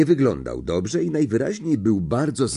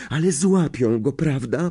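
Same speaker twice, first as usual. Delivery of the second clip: concerned; 6 semitones higher than usual. A narrator's reading. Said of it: "z przejęciem".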